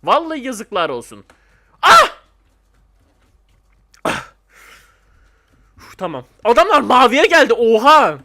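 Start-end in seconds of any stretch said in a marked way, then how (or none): 1.8-2.2 very high loud yelp; sharp shriek; pained cry. Real, not acted. Dies in game. 6.4-8.2 loud disbelieving oha; loud shocked exclamation; astonished shout. Real, not acted. Others reach blue, astonished.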